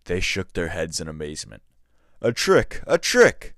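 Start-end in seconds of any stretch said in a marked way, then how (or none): 2.2-3.5 high, loud screaming accusation; screamed. A narrator's reading. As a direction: shriek it, outraged and rising. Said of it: "Khotin screamed".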